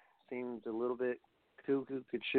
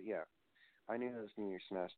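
usual speaking voice

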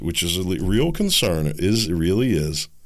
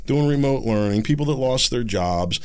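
usual speaking voice

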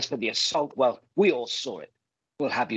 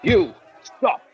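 higher, louder command to halt; calling out; 10 semitones higher, 7 dB louder than usual. Acted. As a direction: shout at him to stop. Calling after fleeing man.

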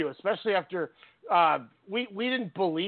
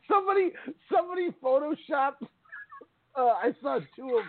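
high-pitched, words squeezed through laughter; real helpless giggling speech; laughing; 8 semitones higher than usual. Real, not acted. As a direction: barely get it out for laughing. Cracking up at the joke.

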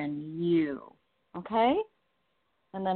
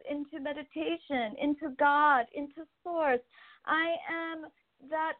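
higher, pleading voice of the cat through the medium; gentle, higher channeled cat voice begging her to stop searching; speaking as the kitty; 10 semitones higher than usual. Acted.

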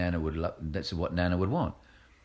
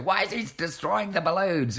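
high, louder indignant question; mock indignant event manager; 12 semitones higher, 5 dB louder than usual. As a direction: play an outraged manager. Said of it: "Even if you're the event manager".

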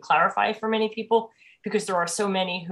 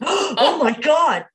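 retold gasp; 8 semitones higher, 7 dB louder, much slower than usual. Gasping at the punchline.

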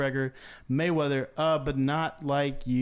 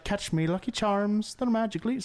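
a slow, hammed-up Irish accent, a touch higher; mock Irish accent; 6 semitones higher, slower than usual. Hosts tease him, mock Irish accent. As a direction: camp up a fake Irish brogue. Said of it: "what was her accent?".

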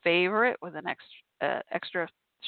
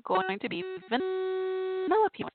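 Vanellope voice; 11 semitones higher, much slower than usual. Acted. Announcing Halloween plan.

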